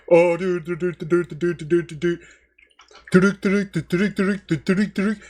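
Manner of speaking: put-on lightly done Indian accent; Indian accent impression. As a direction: rattle off the dudes in a mock Indian accent. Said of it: "doing an impression of an Indian".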